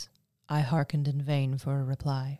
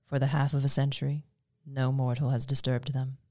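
ordinary delivery

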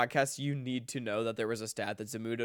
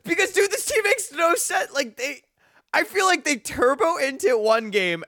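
exasperated; 11 dB louder than usual. Real, not acted. Exasperated rant about the team.

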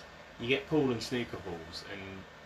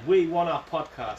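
demanding listeners; 7 semitones higher, 10 dB louder, slower than usual. Retold or imitated.

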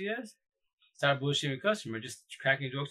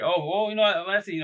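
a touch louder and higher, eager to explain; selling his excuse to the customer with a raised, eager voice; retold eager reply; 7 semitones higher, 8 dB louder than usual. Talking to the customer, an eager raised explaining tone.

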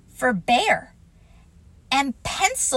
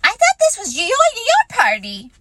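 Piglet voice; 12 semitones higher, 8 dB louder than usual. Acted. Piglet's high small voice.

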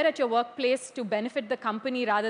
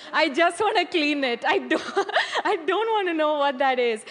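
laughing through the words, a touch louder; 4 semitones higher, 4 dB louder than usual. Retold or imitated. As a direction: giggle while saying it. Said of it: "I would be like".

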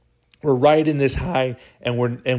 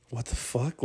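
much softer, shocked whisper; stunned hush; 13 dB quieter than usual. Retold or imitated. Friends stunned by the news, whispering in disbelief. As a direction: breathe it out in disbelief.

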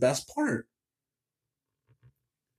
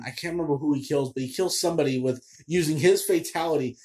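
ordinary talk

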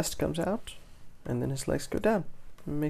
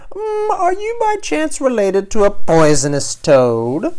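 high, loud sing-song asking; exaggerated innocent query; mock impertinent question; 8 semitones higher, 14 dB louder, slower than usual. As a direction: ask it loud and high, swooping up at the end. Imagined rude question to a teacher.

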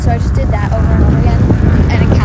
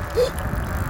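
sharp gasp; 13 semitones higher, 10 dB quieter than usual. Real, not acted. Sudden gasp mid-story.

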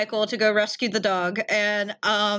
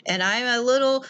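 usual speaking voice

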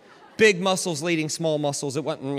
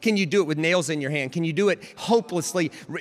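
ordinary talk

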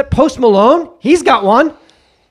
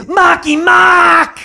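mock girlfriend call; 7 semitones higher, 4 dB louder, much slower than usual. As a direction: yell his nickname across the set. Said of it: "that's not his name".

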